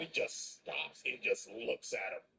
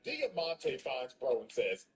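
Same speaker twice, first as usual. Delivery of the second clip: ordinary talk, nothing marked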